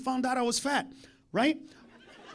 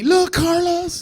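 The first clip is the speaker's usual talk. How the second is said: excited call; 8 semitones higher, 8 dB louder, much slower than usual. Retold or imitated. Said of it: "sending the video".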